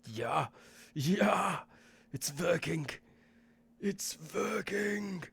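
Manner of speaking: lightly voiced slight German accent, excited; a hint of German accent, drawn out slowly at the end. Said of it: "slight German accent".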